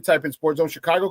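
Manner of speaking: ordinary talk, nothing marked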